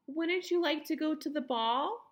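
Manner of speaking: high, sing-song taunt rising at the end; mocking high-voiced stepsister; cruel teasing. A narrator's reading. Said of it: "teasing her cruelly".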